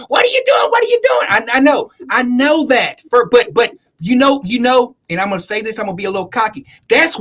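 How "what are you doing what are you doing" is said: high-pitched imitation of a mother; alarmed mother. Mother walks in on dad training the kids, high-pitched mock alarm. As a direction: mimic a mom objecting, voice pitched way up. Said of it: "she would have walked in and be like".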